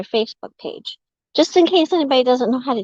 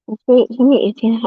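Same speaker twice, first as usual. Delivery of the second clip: ordinary delivery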